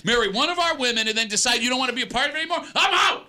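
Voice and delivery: hammed-up indignation exploding on the last words; mock outrage. Radio host scolds the golfer for the town, mock outrage.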